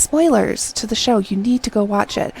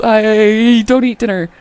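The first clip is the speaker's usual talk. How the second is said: louder, slow panicked blurt, almost a cry; panicky blurt; 3 semitones higher, 6 dB louder than usual. Retold or imitated. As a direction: blurt it out in a mock panic, then flee. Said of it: "she totally panics".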